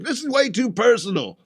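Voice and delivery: high, whiny mock objection; offended protest. Roasted man protests in retelling.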